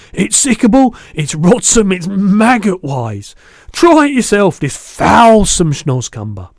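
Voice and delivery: loud, high outburst from a disgusted giant; disgusted BFG. A narrator's reading.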